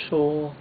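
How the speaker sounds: "So" high, level and drawn out. Shown as a demonstration. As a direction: hold "So" high without falling. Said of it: "high level tone".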